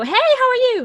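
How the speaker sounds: high, loud, gushing hello; exuberant, sing-out hello; big friendly greeting. Acted. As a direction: call it out as an over-eager greeting. Acting out an outgoing, chatty greeting.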